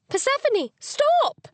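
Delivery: high, loud shout at the dog. Acted. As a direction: yell for the dog to stop. Chasing the dog.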